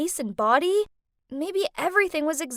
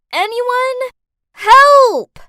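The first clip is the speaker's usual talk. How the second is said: high, loud cry for help; desperate shout for help; 11 semitones higher, 14 dB louder, much slower than usual. Acted. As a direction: yell through a locked door. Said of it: "slammed my hands against the door and shouted".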